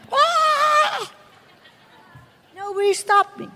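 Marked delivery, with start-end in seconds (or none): 0.1-1.1 high held mock shriek; retold scream. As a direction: imitate a woman screaming, pitched way up. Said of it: "I'm a woman screaming".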